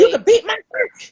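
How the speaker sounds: mother confronting the deputy who beat her son; confrontational. Retold or imitated. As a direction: throw it back at the deputy.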